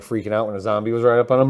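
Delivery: ordinary talk, nothing marked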